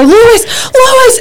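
high calling out his name; retold yell. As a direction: call him urgently, half panicked. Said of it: "So then I start yelling at him".

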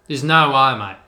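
loud Aussie wildlife host, mate; Australian accent, eager and loud; Steve Irwin. Retold or imitated. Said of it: "I did an Australian accent".

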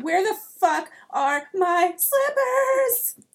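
loud, high, drawn-out scream; indignant shriek at full volume; outraged scream. Acted. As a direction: scream it word by word, stretching the last. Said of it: "I scream".

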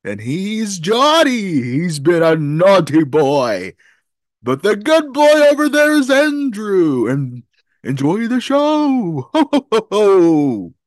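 big booming Santa, slow and sung; jolly ho ho ho. Pretend. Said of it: "I can't believe Santa called us that".

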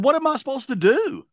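very high helpless wail; retold exasperated protest. Stranded caller asking dispatcher for help, high exasperated wail. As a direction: cry it out, pitch way up.

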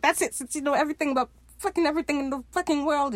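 high, fast exasperated rant; fed-up outburst. Retold or imitated. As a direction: imitate someone who has had enough. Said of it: "Izzy gets tired".

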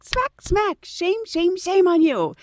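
high-pitched mock scolding; mock self-scolding. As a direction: scold yourself in a high voice, playfully. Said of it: "I literally had to just smack myself".

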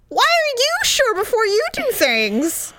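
high, whining exasperation; high exasperated whine. Real, not acted.

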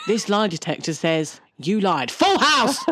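mock Jeremy Kyle verdict; TV host impression. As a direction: whip up the studio crowd. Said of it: "Jezza Kay would be like".

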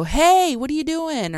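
high sing-out call to someone downstairs; retold calling out. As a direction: holler a high, drawn-out hey, then ask. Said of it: "You yelled".

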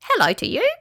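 high, squeaky small creature; little squeaky greeting. A narrator's reading. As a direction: squeak it like a tiny critter. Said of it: "a squeaky little voice squeaked".

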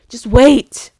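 loud, emphatic burst; emphatic plea. Real, not acted. Pleading with viewers to wait, loud and emphatic. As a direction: punch WAIT out loudly.

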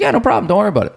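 high, breezy reassurance; breezy salesman. Retold or imitated. Salesman brushing off a worry.

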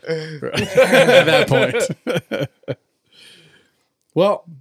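slow, high, laughing aside. Real, not acted. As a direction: laugh through the joke. Joke, laughing through it.

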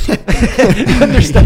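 laughing. Real, not acted. Laughing at his own joke.

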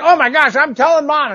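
high, indignant little-sister tattle; tattling sister. Retold or imitated. Sister catching him with the box, indignant high tattle. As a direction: squeal it like a shocked kid sister. Sister threatens to tell mom.

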